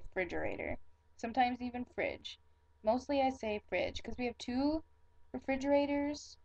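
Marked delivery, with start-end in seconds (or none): 0.0-0.8 refrigerator with no re, "fridgerator"; clipped local pronunciation. Shown as a demonstration. Explaining local speech, refrigerator without its first syllable. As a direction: start refrigerator straight on "fridge". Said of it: "we just take the re off".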